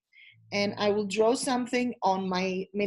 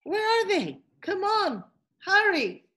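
impatient call; 9 semitones higher than usual. Real, not acted. Teacher waits for students returning.

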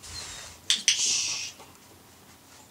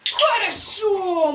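высоко и громко «Хорошо»; восторженно похвали собаку; радостный возглас; 8 semitones higher, 3 dB louder than usual. Real, not acted. (громкая радостная похвала)

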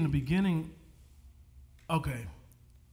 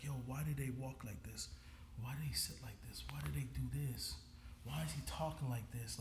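very soft, half-whispered self-questioning; retold thoughts, quietly murmured; hushed musing to himself; 2 semitones lower, 11 dB quieter than usual. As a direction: wonder under the breath while studying someone on screen. Acting out his absorbed film watching.

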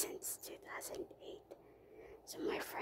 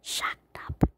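"shacked up" set apart, louder; slang term shown; 14 dB louder than usual. Shown as a demonstration. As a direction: say "shacked up" as a quoted slang word. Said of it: "you guys will hear this word".

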